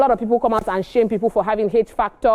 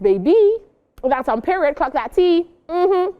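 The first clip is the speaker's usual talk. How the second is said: sassy; 7 semitones higher, slower than usual. Acted. Sassy sign-off to viewers.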